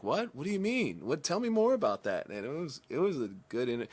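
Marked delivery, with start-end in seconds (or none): 0.0-2.3 high, mock-baffled "what?" questions; puzzled disbelief. Imitating Scholes baffled by the rapper's slang, high incredulous. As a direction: play the confused host who can't follow. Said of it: "Scholes was like".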